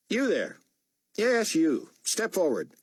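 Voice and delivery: put-on high, firm call to the boy; commanding order. A narrator's reading. Buck summoning the boy from the cage, commanding. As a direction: order him forward.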